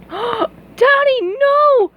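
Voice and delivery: high sobbing plea; crying princess. Acted. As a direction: cry out for your father. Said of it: "cried Mindy".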